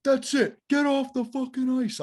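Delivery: high, loud angry coach; exasperated high-pitched shout; imitated yell. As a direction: yell them off the ice. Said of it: "He yells at me again".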